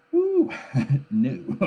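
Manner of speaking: amused hoot dropping into laughter; wry whoop. Real, not acted. Chuckles at the new era idea.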